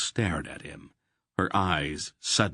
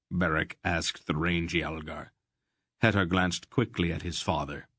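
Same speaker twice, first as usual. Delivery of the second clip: ordinary delivery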